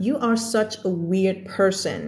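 very slow, careful read; slow example sentence. Shown as a demonstration. Teacher reads an example sentence to students, very slowly. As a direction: drag each word out clearly.

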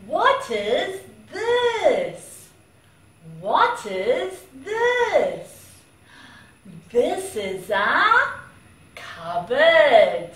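put-on name each object clearly for a small child; reading to a toddler. Teaching vocabulary to a toddler.

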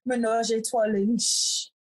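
"Menage a Trois La Viche" said the French way, "Trois" as twah; French pronunciation. Shown as a demonstration. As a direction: lean into the French, "La Viche" as la veesh. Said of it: "I'm going to say it like that because it's French".

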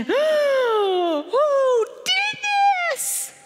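high, stretched-out cry of outrage; outraged father. Retold or imitated. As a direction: wail it as a father appalled at the damage. Father discovers the damage.

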